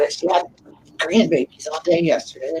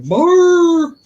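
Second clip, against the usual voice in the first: dog bark imitation; 12 semitones higher, 7 dB louder than usual. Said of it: "he's like".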